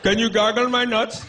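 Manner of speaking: high, loud, slow mock Indian accent; East Indian accent. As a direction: request it in a hammed-up Indian accent. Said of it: "what accent is not sexy, is that East Indian accent".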